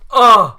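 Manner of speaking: loud, high tearful ugh; overwhelmed loud ugh; anguished groan. Retold or imitated. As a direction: burst of overwhelmed feeling. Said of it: "I was like".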